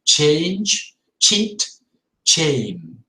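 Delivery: ch in change, cheat, chain; crisp ch on each word; strong ch words. Shown as a demonstration. Teacher drilling ch words. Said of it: "the sound is a little bit stronger".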